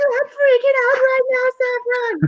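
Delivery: put-on very high, overexcited kid voice; freaking-out boy. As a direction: squeak it like a child losing it.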